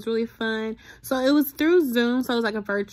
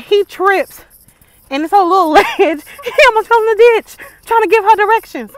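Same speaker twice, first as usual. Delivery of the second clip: excited retelling; 9 semitones higher, 14 dB louder than usual. Real, not acted. Laughing retelling a guy tripping.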